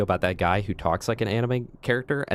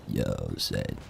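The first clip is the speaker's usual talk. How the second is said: much lower, softer gruff voice; deep voice; 5 dB quieter than usual. Acted. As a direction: drop the voice down low and soft. Very deep put-on voice.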